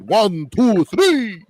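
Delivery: counting like the Count; Transylvanian accent. Acted. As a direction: Count von Count voice, dramatic. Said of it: "Good, good count voice".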